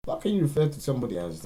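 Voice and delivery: loud, high, fast outraged question; high loud incredulous protest; exasperated. Real, not acted. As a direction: fire it off in disbelief.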